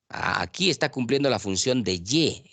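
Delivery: usual speaking voice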